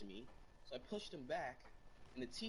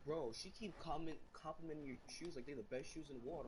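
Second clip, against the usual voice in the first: put-on jealous kid; 5 semitones higher than usual. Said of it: "one kid was jealous".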